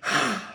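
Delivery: short imitated exasperated sigh; infamous sigh. Husband showing the congregation his wife's infamous sigh. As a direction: let out a mock wifely huff. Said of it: "that infamous".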